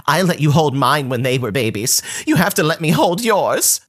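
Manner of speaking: put-on cousin voice climbing high on you have to; mock entitled cousin. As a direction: insist like it's owed to you. Cousin argues she deserves it.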